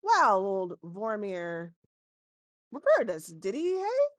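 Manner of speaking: a gnome chuckling at a rival's referral; lightly voiced gnome, amused; gnome shopkeeper. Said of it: "And he says".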